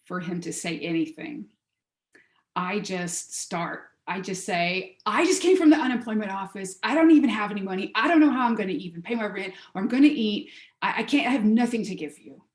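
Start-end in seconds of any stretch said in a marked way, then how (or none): 5.1-12.4 loud, rushed, higher-pitched protest; flustered outburst. Retold or imitated. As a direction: blurt it out in a rapid, overwhelmed rush. Retold outburst at man begging.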